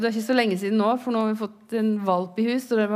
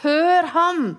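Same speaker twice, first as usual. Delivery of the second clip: calling out; 9 semitones higher, 4 dB louder, slower than usual. Retold or imitated. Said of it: "Faderen sier om Jesus".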